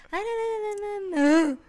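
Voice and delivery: a hint of a fake cry; mock whiny no. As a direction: play a coy, whiny refusal. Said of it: "Agora ficar, tipo".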